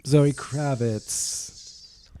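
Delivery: very slow, savouring the name; drawn-out echo. Real, not acted. Host echoing the co-host's pick, slow and savoured. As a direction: stretch each syllable out.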